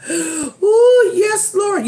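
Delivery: high, loud joyful squeal; delighted squeal. Real, not acted. Reacts to ice cream flavor.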